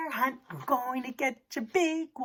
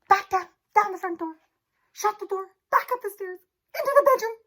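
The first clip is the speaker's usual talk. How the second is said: high, loud, breathless hurry; frantic rush; 8 semitones higher, 4 dB louder, faster than usual. Pretend. Teacher fleeing the bear with the class, frantic. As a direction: race the words home in a panic.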